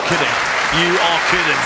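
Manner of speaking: loud, high disbelief; can't believe that shot; amazed. Real, not acted. Shocked reaction to great shot.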